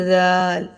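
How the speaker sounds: زال with a gentle th as in "this"; soft dhal. Shown as a demonstration. Teacher contrasts two letters, soft lisped زال. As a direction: hold زال long, no whistle. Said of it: "بہت نرمی سے پڑھا جائے گا".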